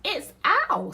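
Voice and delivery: high, loud little mouse voice; mouse character. Acted. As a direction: pipe up as a confident mouse. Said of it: "said the Mouse".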